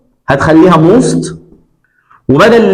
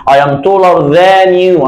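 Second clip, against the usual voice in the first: I am taller than you, very slow and clear; slow example; much slower than usual. Shown as a demonstration. Teacher modelling a comparative sentence, slowly. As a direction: lay the sentence out word by word. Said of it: "ثم أجيب من اللي هو الشخص".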